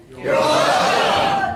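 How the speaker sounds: loud, drawn-out crowd-style response; big, slow, shouted courtesy; loud rehearsed reply. Shown as a demonstration. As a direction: belt it out slowly to a legend. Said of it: "That's how you say it".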